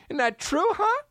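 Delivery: squeaky high teasing; high pitched mock taunt. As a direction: rub it in with a sing-song jab. Taunting horse owner.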